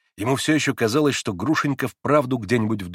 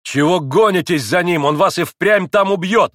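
raised, loud, exasperated scolding; heated, higher-pitched rebuke; angry shout; 6 semitones higher, 6 dB louder than usual. A narrator's reading. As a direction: shout angrily at a reckless father. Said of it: "гневно крикнул на отца".

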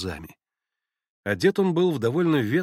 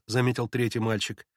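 ordinary delivery

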